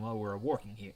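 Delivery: "working" slipped in for "walking"; misreading. Real, not acted. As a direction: read it with "working" by mistake. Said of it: "I can't speak today".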